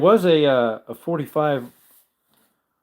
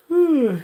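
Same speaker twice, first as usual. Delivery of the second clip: big yawning sigh; 5 dB louder than usual. Real, not acted. Tired streamer, yawning.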